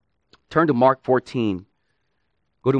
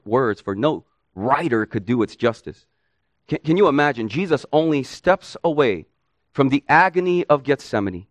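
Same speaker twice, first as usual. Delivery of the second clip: ordinary talk, nothing marked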